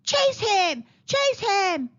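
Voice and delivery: high, loud falling yells; monkeys' yell. A narrator's reading. Monkeys yelling after a mango thief, high yell. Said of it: "the monkeys yelled".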